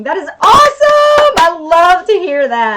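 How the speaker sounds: loud, high burst of enthusiasm; thrilled, voice leaping up on awesome; delighted. Real, not acted. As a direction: cheer it out with real delight. Delighted by a viewer's message.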